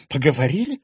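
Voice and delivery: wife indignantly correcting her husband. Acted. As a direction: scoff at his understatement, outraged. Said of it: "возмутилась Виктория".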